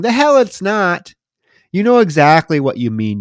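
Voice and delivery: loud, high, scoffing comeback; mock indignant retort. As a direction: fire back at her in mock outrage. Indignant retort to film character.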